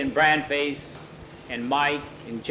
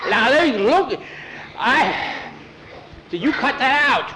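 put-on indignant boy; 10 semitones higher, 3 dB louder than usual. Boy scolding in story.

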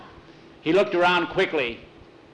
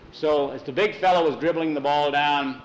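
plain reading voice

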